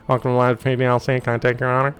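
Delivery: tinny drive-through order voice; crackly fast-food intercom; drive-through speaker voice. Pretend. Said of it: "Bad drive-through speaker?".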